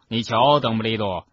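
lightly voiced sly needling; a touch of smug cunning. A narrator's reading. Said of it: "菲尼阿斯·纳杰莱斯狡黠地说，".

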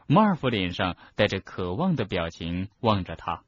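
ordinary delivery, nothing marked

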